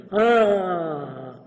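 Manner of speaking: long, high, falling "aah" of realization; imitated drawn-out gasp of wonder; amazed "ah". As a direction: let a big "ahh" slide down.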